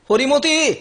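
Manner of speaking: calling out. Acted. Calling the maid for tea.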